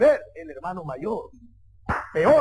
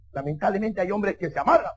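ordinary delivery

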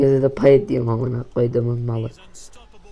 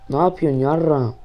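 ordinary talk, nothing marked

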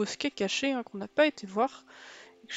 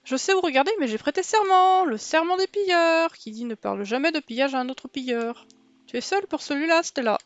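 higher, louder pirate character; 5 semitones higher, 6 dB louder than usual. Acted. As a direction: play a boisterous treasure-hunting pirate. Game pirate character Buck speaks.

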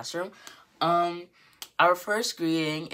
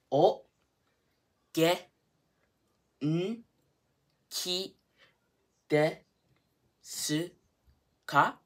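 very slow, long pause after each syllable; isolated syllables, carefully spaced; 4 semitones higher, much slower than usual. Shown as a demonstration. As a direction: sound out each beat separately for beginners. Teacher sounds out phrase for learners.

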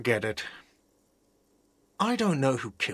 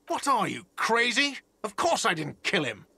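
indignant outburst; 7 semitones higher than usual. Acted. Accused of the murder.

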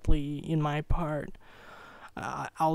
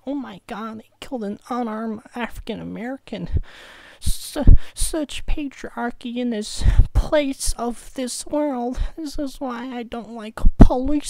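higher, louder mock indignation; sneering parody of an outraged protester; mocking angry liberal; 8 semitones higher, 10 dB louder than usual. Mimicking liberals angry about the police shooting, high mock outrage. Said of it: "They're like".

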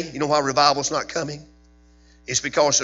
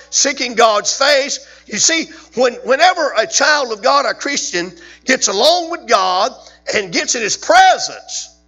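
rising, louder fervent sermon cadence; preaching at full force; 6 semitones higher, 6 dB louder than usual. Acted. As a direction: drive it home like a revival preacher.

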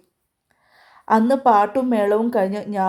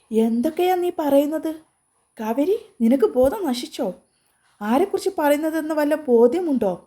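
higher, indignant outburst; angry disbelief; 6 semitones higher than usual. Acted. Ami to her friend on the phone, higher, angry disbelief. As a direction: pitch jumps up in shocked anger. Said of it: "ആമിക്ക് നല്ല ദേഷ്യം വന്നു".

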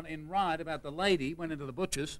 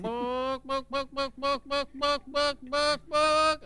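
clucks climbing higher and louder; chook clucking; 7 semitones higher, 4 dB louder, much slower than usual. Acted. Chook at the library all day, clucking louder and higher. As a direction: build the hen clucks up and up. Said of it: "This goes on".

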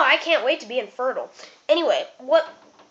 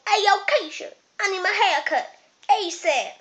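higher-pitched sassy call to a barber; 6 semitones higher than usual. Retold or imitated. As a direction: act out hollering across the shop. Acts out calling to barber.

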